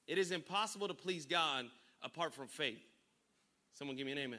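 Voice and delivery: ordinary delivery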